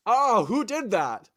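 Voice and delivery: high, loud, slow bellow; mock pained outcry. Blinded Cyclops seeking his attacker, mock pained yell. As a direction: cry out in hammed-up pain.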